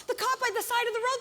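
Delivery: ordinary delivery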